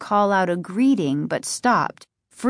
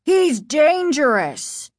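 slow, higher alarmed warning; mild urgent warning to the kids; father warns kids about the double; exclamation; 7 semitones higher, 4 dB louder, slower than usual. Acted.

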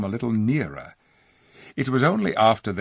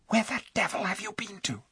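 angry whisper, higher voice; furious whisper; 12 semitones higher, 5 dB quieter than usual. A narrator's reading. Eustace to Jill after she vanished, furious whisper. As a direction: hiss it at her, scared and cross. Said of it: "said Eustace in a furious whisper".

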